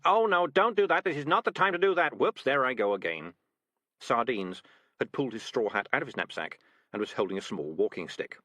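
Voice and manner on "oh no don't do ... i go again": louder, higher cat voice; cat pretending another outburst; Morris's voice. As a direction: fluster through the fake fit.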